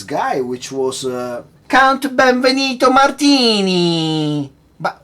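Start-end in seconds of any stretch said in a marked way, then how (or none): 1.7-4.5 loud, high, drawn-out hammy Italian accent; fake Italian-American accent. Shown as a demonstration. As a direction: belt it slowly in a wide fake Italian accent. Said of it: "wide vocal thing".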